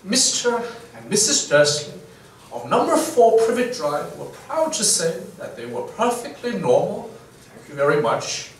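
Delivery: mimicked British accent, reading aloud. Shown as a demonstration. Host reading a novel's opening to mimic British, British accent. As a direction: read it out in a put-on British accent. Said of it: "to mimic the British accent".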